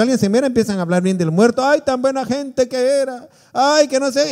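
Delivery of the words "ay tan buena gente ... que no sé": fake mourning praise. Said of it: "y puras mentiras".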